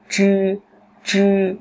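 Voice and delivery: "chư" with tongue curled back, unaspirated; curled-tongue sound. Shown as a demonstration. Teacher drills students, "chư" retroflex and unaspirated. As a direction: hold "chư" high and level, no air puff. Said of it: "vị trí như trờ nặng".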